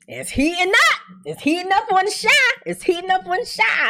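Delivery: high-pitched, building excitement; excited hype. Real, not acted. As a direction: sell the hype of the show. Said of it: "hyping episode".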